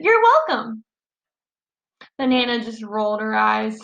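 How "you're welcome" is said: very pleasant. A narrator's reading. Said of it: "very pleasant".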